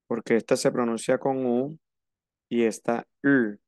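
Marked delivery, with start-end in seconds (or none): none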